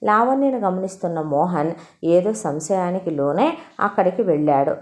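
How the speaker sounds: ordinary delivery, nothing marked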